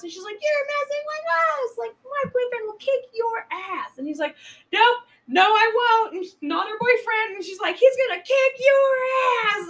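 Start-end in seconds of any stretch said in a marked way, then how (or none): 0.4-4.0 squeaky, high indignant comeback; high mock outrage. Missy snapping back at the couple. 4.7-7.4 mock loud denial. The man denying loudly. 7.8-9.7 high, loud mock threat; shrill loud threat. Missy threatening loudly.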